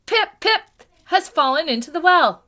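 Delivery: high, loud, last gasps of breath; gasping. A narrator's reading. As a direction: gasp out the last words. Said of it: "he gasped".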